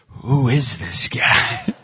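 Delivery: low, slow, drawn-out disbelief; baffled onlooker. Retold or imitated. Kids staring at the man yelling at them, baffled. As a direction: imitate bewildered kids sizing up a stranger. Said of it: "they look at me like".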